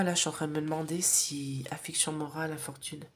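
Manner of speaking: unvoiced whisper, asking the oracle; whispering to cards. Real, not acted. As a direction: breathe the question to the cards. (chuchoté, question à l'oracle)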